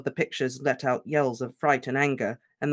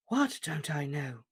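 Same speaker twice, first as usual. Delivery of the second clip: slow, softer calm question; unruffled, gentle slow question; 5 dB quieter, slower than usual. Acted. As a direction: ask it calmly and slowly. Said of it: "said Dumbledore calmly".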